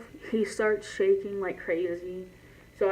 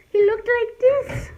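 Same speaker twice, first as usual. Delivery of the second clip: squealy exclaim; 8 dB louder than usual. Real, not acted. Showing the dog, exclaiming.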